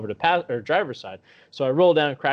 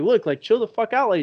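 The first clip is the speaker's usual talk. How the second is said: higher, urgent retold warning; exasperated protest; 7 semitones higher, 4 dB louder than usual. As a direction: re-enact fending her off, pitched up. Retold telling attacker to back off.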